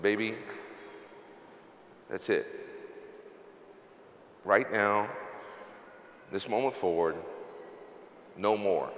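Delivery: understated, low, final decision; quiet resolve. Retold or imitated. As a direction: lay down a hushed promise, pausing after each phrase. Retold solemn vow to his wife.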